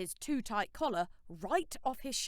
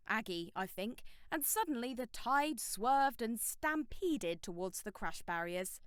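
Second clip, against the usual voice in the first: ordinary delivery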